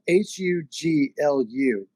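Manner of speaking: letter by letter, H U G L U; each letter of H U G L U named separately; spelled out. Shown as a demonstration. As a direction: spell out H U G L U one letter at a time. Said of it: "it's spelled".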